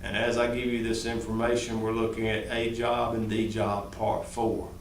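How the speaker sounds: mock monotone of a dull lecturer; boring monotone. Shown as a demonstration. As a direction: drone on like a dull teacher putting the class to sleep. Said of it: "I'm not sitting up here going".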